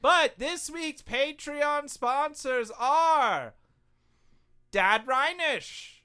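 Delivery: very slow, drawn-out announcement; announcer voice. Acted. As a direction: build it up like a big reveal. Announcing the Patreon sponsors.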